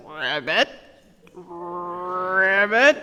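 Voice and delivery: frog croak. Shown as a demonstration. Reading to children, frog croaking rabbit rabbit. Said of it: "this one goes".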